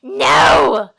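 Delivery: loud panicked shout. Acted. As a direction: scream it as they grab you. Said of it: "Slynt cried".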